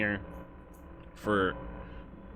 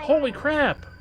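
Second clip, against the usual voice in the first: high-pitched real astonishment; amazed exclamation; 4 dB louder than usual. Real, not acted. Streamer stunned by viewer's donation, amazed.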